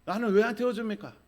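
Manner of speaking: loud, high-pitched shouted protest; imitated shouting. Left-behind soul demands a ride, mock shouting. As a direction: yell it as an indignant demand. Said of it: "막 소리를 질러요".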